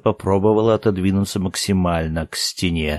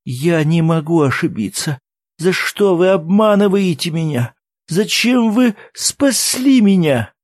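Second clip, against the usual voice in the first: high, louder tearful outcry; sobbing aloud; 9 semitones higher, 5 dB louder than usual. A narrator's reading. Woman accuses men of deceiving her, sobbing aloud. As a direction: build up into a wailing plea. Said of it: "Теперь она уже рыдала в голос".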